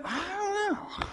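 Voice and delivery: high, slow, dithering "I don't know"; whiny indecisive. Acted. Indecisive spouse dodging a dinner invite, high and slow. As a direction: drag it out helplessly. Acted indecisive couple call.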